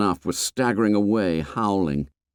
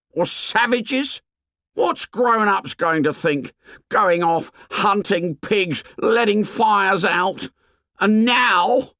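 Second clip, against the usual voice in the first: put-on high, anxious scolding boy; high agitated Piggy; 12 semitones higher than usual. A narrator's reading. Piggy scolds the assembly, high and agitated. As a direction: berate them in a high, worried voice.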